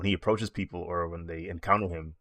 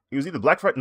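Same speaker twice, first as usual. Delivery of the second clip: a bit louder and up, guessing; louder tentative guess; half-sure guess; 7 semitones higher, 6 dB louder, much faster than usual. Real, not acted. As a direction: settle on it with a louder push. Unsure recall of sale date.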